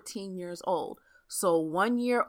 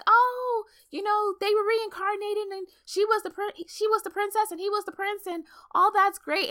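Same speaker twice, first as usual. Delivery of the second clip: much higher, bright gushing fangirl voice; naive young fan. Retold or imitated. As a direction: play your starry-eyed younger self, way up high. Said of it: "I was like".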